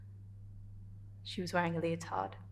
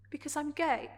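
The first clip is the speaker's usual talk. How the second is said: higher-pitched, held-back confession; vulnerable admission. Acted. Character explaining to Adrian why she can't, vulnerable. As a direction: confess it delicately, after the outburst.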